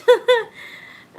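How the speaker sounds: high teary laugh through tears; tearful laugh. Real, not acted. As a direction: laugh while crying, loud and high. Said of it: "I'm sorry I'm crying so much tonight".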